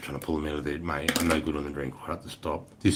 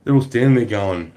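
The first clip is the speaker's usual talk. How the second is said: normal talking voice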